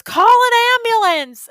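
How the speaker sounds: high, loud panicked shout; retold yell. Lady in her car yells after the crash, loud shout. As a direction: yell it like a bystander in alarm. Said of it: "One lady yells from the curve".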